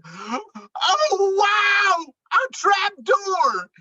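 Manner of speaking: a high, loud "Oh, wow!"; delighted surprise. Acted. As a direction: gasp with glee at the discovery. Moose discovers the trap door.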